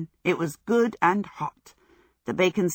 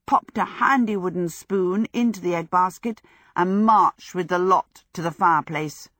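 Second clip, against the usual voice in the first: usual speaking voice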